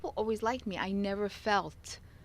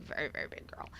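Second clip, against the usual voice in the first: hushed aside; 6 dB quieter than usual. Real, not acted. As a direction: drop to a soft near-whisper. Softer repeat of the same words.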